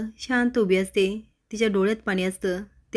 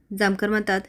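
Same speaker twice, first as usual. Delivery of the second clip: plain reading voice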